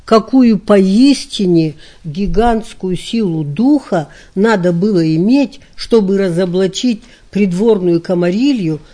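usual speaking voice

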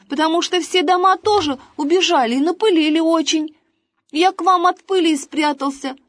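put-on high, eager boy rambling on; chatterbox boy. A narrator's reading. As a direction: chatter away like a kid.

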